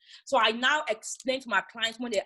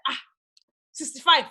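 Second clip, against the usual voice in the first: a mock-scandalised high cry of the price; imitating a client balking at the cost; louder, higher mock outrage over the price; retold shocked client; 6 semitones higher, 6 dB louder than usual. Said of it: "And she's like".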